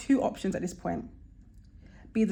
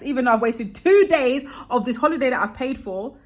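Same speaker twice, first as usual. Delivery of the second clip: ordinary talk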